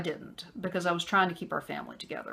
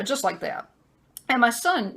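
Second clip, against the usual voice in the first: ordinary talk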